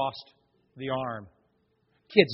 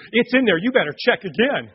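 loud insistent protest, pitched up; indignant lady; 6 semitones higher, 9 dB louder than usual. Retold or imitated. Offended woman at the judgment table insisting, loud. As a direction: demand they look again, louder. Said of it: "she goes".

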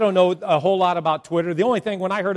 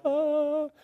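dazed lovestruck oh; 9 semitones higher, 7 dB quieter than usual. Retold or imitated. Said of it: "people are like".

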